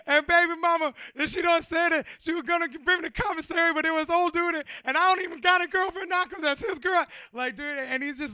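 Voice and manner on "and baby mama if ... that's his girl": loud, high-pitched hammed-up wailing; imitation of a man wailing his troubles; mock crying rant. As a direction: blubber it out in an exaggerated lament. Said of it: "hugging me and crying and saying all kinds of wild shit".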